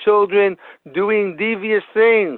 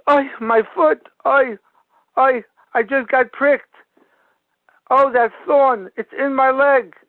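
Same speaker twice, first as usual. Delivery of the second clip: higher-pitched mock cry of pain; imitated pained shout; 8 semitones higher than usual. Son pricked by a thorn in the woods, imitated shout. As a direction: act out a hurt boy's yelp without really shouting. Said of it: "the son gives a shout".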